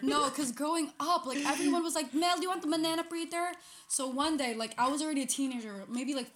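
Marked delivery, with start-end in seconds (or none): 2.1-3.5 put-on relatives' Filipino accent, pitched up. Retelling what everyone asked growing up, Filipino accent. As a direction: imitate a Filipino offer, "preeter" for fritter. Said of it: "with the Filipino accent".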